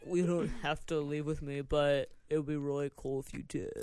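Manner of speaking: low, soft sleazy voice; douche guy. Retold or imitated. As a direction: creep leaning in close. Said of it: "capital douche guy being like".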